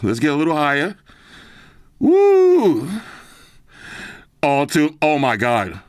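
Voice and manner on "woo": rowdy party animal. Real, not acted. Hyped up testing loud headphones.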